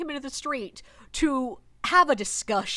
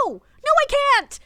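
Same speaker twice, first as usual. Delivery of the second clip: much higher and louder, helpless no no; panicked squeak; 14 semitones higher, 8 dB louder than usual. Retold or imitated. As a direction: squeal in despair at the coworkers. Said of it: "And I'm like".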